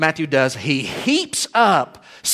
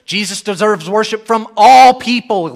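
raised voice, "all" hit loud and long; stress on "all people"; 6 semitones higher, 7 dB louder than usual. Shown as a demonstration. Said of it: "every word is emphasized".

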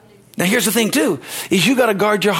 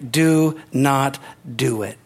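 slow emphatic; 4 semitones lower, much slower than usual. Shown as a demonstration. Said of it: "If you can't do it in faith".

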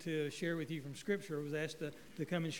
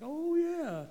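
pleased recognition; 11 semitones higher, 5 dB louder than usual. Retold or imitated. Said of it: "it's like".

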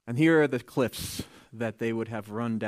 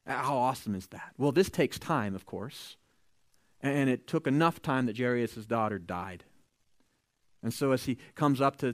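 usual speaking voice

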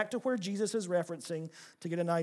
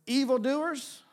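ordinary delivery

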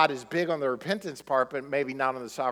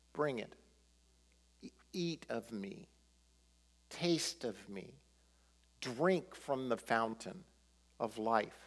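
very soft, low and unhurried, with space between phrases; gentle divine invitation; 5 semitones lower, 11 dB quieter than usual. Acted. Preacher voices God inviting the church to eat and drink, soft and tender. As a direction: invite them tenderly, almost under the breath.

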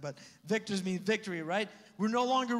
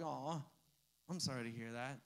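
a much softer, lower "Aw, I'm sorry to hear that"; hushed mock sympathy; 7 semitones lower, 11 dB quieter than usual. Shown as a demonstration. Pastor mocking careless sympathy, much softer and lower. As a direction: let it sink into a soft, lower, hollow sigh of pity. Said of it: "you're like".

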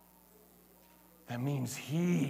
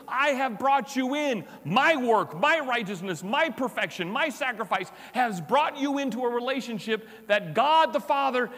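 higher, louder preaching push; impassioned preaching; 9 semitones higher, 4 dB louder than usual. Acted. Pastor urging the church they are family, fervent. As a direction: drive it home to the congregation.

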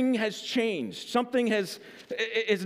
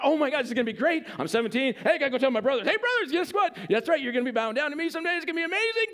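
high, fast, gleeful bragging to his brothers; excited teen boasting; 5 semitones higher, much faster than usual. Retold or imitated. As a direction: rattle it off like a cocky kid who can't wait.

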